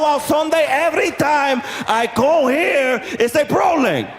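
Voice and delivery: put-on high-pitched, exasperated accented tirade; Spanish guy losing it. Spanish man fed up with the bus every day, losing it. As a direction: keep the mock outrage high, with a Spanish accent. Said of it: "this guy lost it".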